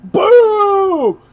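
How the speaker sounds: loud, high mouth-made boom; imitated gunshot boom. Retelling a friend firing, a loud gunshot boom. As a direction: shout boom like a shotgun blast.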